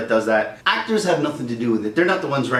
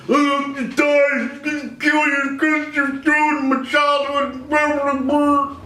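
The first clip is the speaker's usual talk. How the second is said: high, slow whining rant; mock the raging fan, high and drawn out; a toxic fan ranting at the director, high and slow; whiny angry fan; 10 semitones higher, much slower than usual. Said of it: "one of those that".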